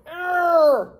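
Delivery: high, loud straining groan; loud bridge groan. Acted. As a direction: groan like a bridge about to break. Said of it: "and groaned".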